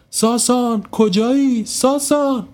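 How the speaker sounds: a mother yelling for her lost son; shouting a name. Acted. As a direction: call into the dark for the boy. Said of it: "بلند داد زد".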